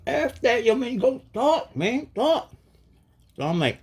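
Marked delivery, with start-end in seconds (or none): 0.1-2.5 high, slowed voice of the spirits urging him on; spirit beings voice. Retold or imitated. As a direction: play the otherworldly beings cheering him on, pitched way up. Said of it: "They like".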